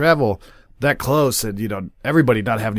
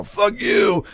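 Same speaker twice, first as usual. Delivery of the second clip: held-back yell in a raised pitch; imitated yell; 9 semitones higher than usual. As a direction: mimic someone yelling from a scuffle. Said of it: "or somebody yell".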